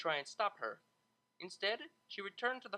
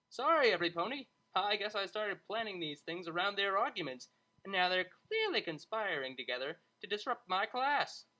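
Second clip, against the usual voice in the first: mock-aggrieved tutor; 4 semitones higher, 5 dB louder than usual. Tutor apologizes, blames the pair.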